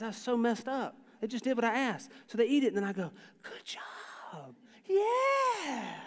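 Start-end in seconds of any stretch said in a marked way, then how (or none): 3.4-4.6 hushed, very soft whisper; whispered praise. Retold or imitated. Dad to baby who ate the food, whispered praise. 4.8-5.9 very high, drawn-out cheer; celebrating cheer. Retold or imitated. Dad celebrating baby eating, high drawn-out cheer.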